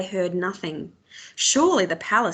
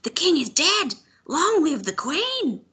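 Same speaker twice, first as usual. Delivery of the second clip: put-on straining old woman's cry; 8 semitones higher than usual. A narrator's reading. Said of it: "voice scratchy and breaking from the effort".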